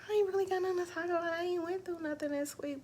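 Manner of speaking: fast, high, dismissive mock voice; mock downplaying. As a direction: brush it off quickly in a put-on voice. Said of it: "It was like".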